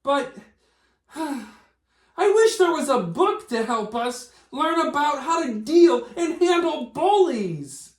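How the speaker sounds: high puppet character voice; squeaky-free high puppet voice. Acted. A puppet character Fred speaks.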